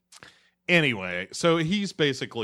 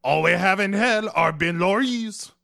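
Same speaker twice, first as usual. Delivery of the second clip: much higher, loud hammed-up devil; mock devil voice; 11 semitones higher, 8 dB louder than usual. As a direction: squeal it like a cartoon demon. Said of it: "devil voice again".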